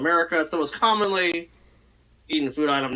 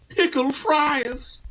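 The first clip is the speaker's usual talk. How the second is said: slow, high drawn-out announcement; unveiling a mystery menu item to his friend, slowly and pointedly; dramatic reveal; 9 semitones higher, much slower than usual. Acted. Tease the friend with a slow, high reveal.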